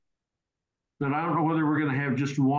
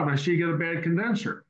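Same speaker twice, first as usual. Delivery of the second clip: normal talking voice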